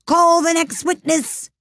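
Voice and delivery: slow, high royal summons; imperious call. A narrator's reading. King resuming the trial, slow high call. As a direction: draw the summons out, high and ringing.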